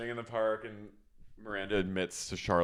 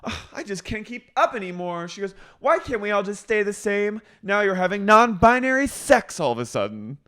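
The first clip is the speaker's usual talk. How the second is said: high, whiny mock complaint; exasperated Charlotte; 14 semitones higher, 8 dB louder than usual. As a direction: whine like the world moves too fast. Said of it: "And then Charlotte goes".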